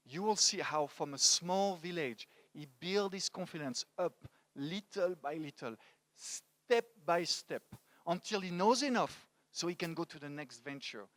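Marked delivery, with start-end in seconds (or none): 4.5-7.6 slow, separated beats on STEP by STEP; step-by-step emphasis. Real, not acted. Speaker telling how a colleague built confidence, slow step-by-step emphasis. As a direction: stretch each step out, one at a time. Gradual progress shown by slow delivery.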